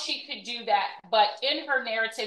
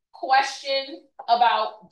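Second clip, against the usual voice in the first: ordinary delivery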